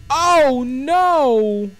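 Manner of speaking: high, drawn-out shout; big, stretched-out yell of dismay; loud cry of dismay. Real, not acted. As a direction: wail it loudly in alarm. Dismay at a game event.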